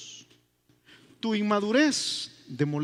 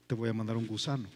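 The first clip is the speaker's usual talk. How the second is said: much lower and softer, calm and knowing; God's voice; 8 semitones lower, 6 dB quieter than usual. Acted. God gently questions the angry prophet, low and soft. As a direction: speak as a patient God, low and hushed. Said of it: "viene Dios y le dice".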